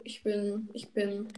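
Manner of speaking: whispered words. Real, not acted. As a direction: breathe it out, no voice. Whispered teasing.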